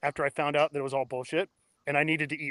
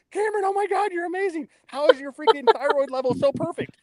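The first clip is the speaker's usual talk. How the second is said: much higher, gushing over-the-top praise; gushing doctor impression; 12 semitones higher than usual. Doctor gushing over her patient's perfect thyroid, squealing delight. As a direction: hype him up like a starstruck fan. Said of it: "she would amp me up".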